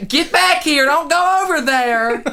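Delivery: high-pitched retold mom holler; yelling mom. Mom calling to a wandering child, high mock holler. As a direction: imitate a mom calling the kids back. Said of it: "the mom is just like".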